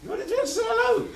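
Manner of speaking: mock high-pitched protest; high squeak. Partner misreads a hello.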